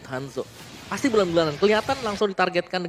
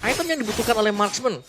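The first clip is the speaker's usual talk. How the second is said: excited caster; 7 semitones higher, 3 dB louder than usual. Acted. Caster hyped analysis.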